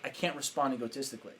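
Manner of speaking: soft, calm self-talk; neutralizing himself. Retold or imitated. Coach talking himself down on stage, calm and soft. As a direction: keep it low-key and controlled. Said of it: "I'm, like, neutralizing myself".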